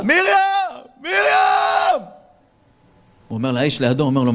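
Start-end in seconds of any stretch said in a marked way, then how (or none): 0.0-2.0 long, slow, high screaming call; madman's scream. Acted. Jilted madman calling for Miriam, long high howl. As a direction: howl her name down the corridor.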